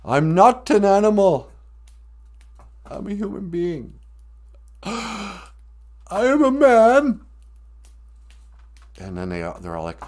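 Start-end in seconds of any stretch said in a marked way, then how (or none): 0.0-7.2 higher, loud hammed-up cry, slowing at the end; mock Elephant Man plea. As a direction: plead like a cornered man, overdone. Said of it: "the Elephant Man goes".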